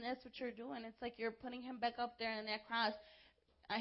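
ordinary talk, nothing marked

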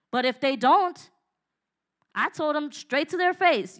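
ordinary talk

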